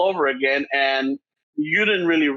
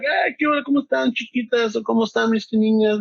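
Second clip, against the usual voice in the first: put-on much higher, singsong hello to the girls; cheery greeting; 7 semitones higher than usual. Dad greeting his little girls at home, cheery. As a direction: act out coming home cheery, pitched way up. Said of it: "I get home a little cheery".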